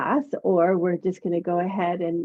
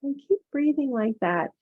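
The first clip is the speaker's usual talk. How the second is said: soothing massage murmur. Acted. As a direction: ease them into calm breathing. Yoga teacher guides breathing meditation.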